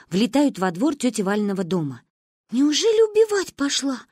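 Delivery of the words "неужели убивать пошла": high-pitched alarmed question; frightened child. A narrator's reading. Manya fears Ba went to kill, high frightened question. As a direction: ask it like a scared little girl. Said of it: "испугалась Маня".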